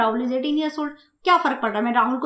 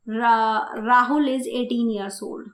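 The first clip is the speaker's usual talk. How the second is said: slow dictation; 3 semitones lower, much slower than usual. Shown as a demonstration. Teacher dictating example sentence.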